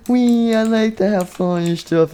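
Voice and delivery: high, giddy character voice; gleeful squeal. Acted. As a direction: start on a long high squeal of glee. JJ keeps cheering.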